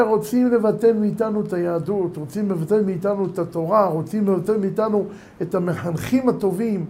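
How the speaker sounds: ordinary delivery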